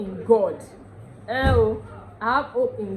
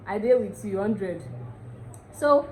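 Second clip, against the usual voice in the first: ordinary talk, nothing marked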